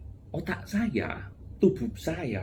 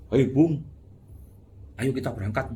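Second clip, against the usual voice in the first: casual loud call; 3 semitones higher, 4 dB louder than usual. Pretend. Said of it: "nggak mungkin kita bilang".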